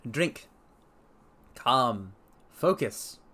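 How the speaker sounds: usual speaking voice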